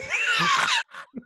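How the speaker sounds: high burst of helpless laughter; squealing laugh; shrieking laugh. Real, not acted. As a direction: crack up high and loud. Laughing mid story.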